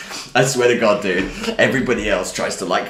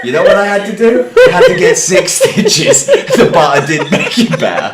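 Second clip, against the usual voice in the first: louder, laughing through the punchline; 8 semitones higher, 10 dB louder than usual. Real, not acted. Telling a friend the butter didn't help, laughing. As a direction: still giggling as you land it.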